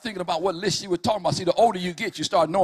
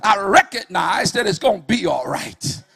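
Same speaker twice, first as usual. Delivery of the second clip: whooping preacher at full force; full-force preaching; 2 semitones higher, 7 dB louder than usual. Acted. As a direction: preach it hard, riding the music. Preaching over music.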